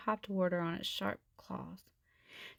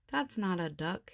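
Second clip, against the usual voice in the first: plain reading voice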